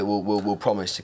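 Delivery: ordinary talk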